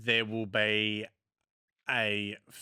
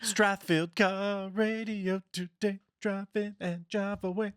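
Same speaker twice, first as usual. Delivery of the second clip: radio ad jingle voice; 8 semitones higher than usual. Retold or imitated. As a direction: croon it like an old radio jingle. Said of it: "same guy that used to do the Avalon ones".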